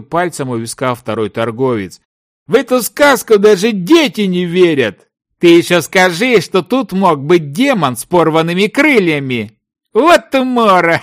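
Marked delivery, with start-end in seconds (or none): 2.5-11.0 high-pitched, incredulous mockery; scornful ridicule. A narrator's reading. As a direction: make fun of a tall tale. Partner ridicules the story. (насмешливо, на повышенных тонах)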